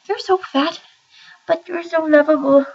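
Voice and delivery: high sing-song coo to a pet. Real, not acted. Owner coos at her fat cat Carson, high baby talk.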